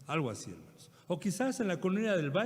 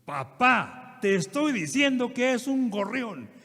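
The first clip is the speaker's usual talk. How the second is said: higher, louder exasperated outburst; desperate yelling son; 6 semitones higher, 6 dB louder, slower than usual. Retold or imitated. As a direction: yell it at the old father, fed up. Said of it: "desesperado le dice".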